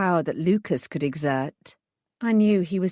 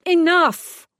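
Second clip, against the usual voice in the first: much higher, much louder roar; 11 semitones higher, 8 dB louder than usual. A narrator's reading. Detective roars to stop the knife attack. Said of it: "I roared".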